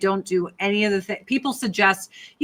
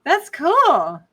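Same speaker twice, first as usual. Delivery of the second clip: high, loud forced brightness; fake enthusiasm; 12 semitones higher, 5 dB louder, slower than usual. Telling strangers about a friend, fake delight. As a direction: pretend to be impressed.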